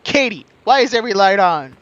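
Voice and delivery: loud, high imitation of a scolding; hammed-up shout through the house; mock parent yell. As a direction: holler it like an exasperated parent. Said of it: "come home to this house like".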